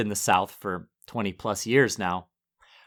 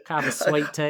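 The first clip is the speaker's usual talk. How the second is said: Southern accent; 3 semitones higher than usual. Shown as a demonstration. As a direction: say "sweet tea" like a true Southerner. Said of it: "I've never heard your Southern accent before".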